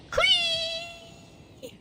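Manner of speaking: normal talking voice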